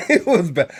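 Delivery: said through a laugh. Real, not acted. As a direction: laugh the words out. Repeats it with a laugh.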